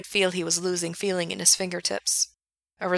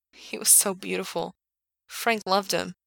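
usual speaking voice

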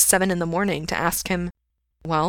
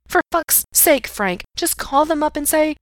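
exasperated urging; 10 semitones higher, 4 dB louder than usual. A narrator's reading. Friend urges him to phone them, exasperated. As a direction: plead up high, out of patience.

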